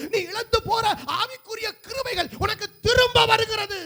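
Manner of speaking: high, shouted sermon climax; impassioned shouted declaration; full-force prophetic preaching. Real, not acted.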